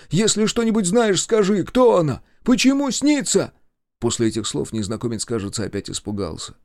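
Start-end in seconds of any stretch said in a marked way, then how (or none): 0.1-3.5 high, louder pleading; urgent plea climbing in pitch; imploring. A narrator's reading. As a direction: beg desperately for an answer. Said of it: "не выдержав, взмолился он".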